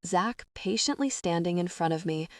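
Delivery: ordinary delivery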